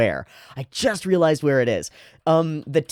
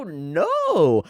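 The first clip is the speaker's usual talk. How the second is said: ordinary talk, nothing marked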